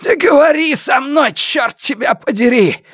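high, strained rasping demand; hoarse. A narrator's reading. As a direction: rasp it out at a high, forced pitch. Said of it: "прохрипел Сяо Вон". (хриплый надсадный выкрик)

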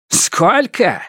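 high-pitched incredulous echo; indignant disbelief. A narrator's reading. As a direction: throw the question back, affronted. Outraged echo of money offer.

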